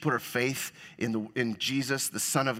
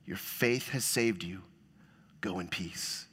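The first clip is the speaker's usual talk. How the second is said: soft blessing; 7 semitones lower, 7 dB quieter, slower than usual. Retold or imitated. Jesus blessing, read softly.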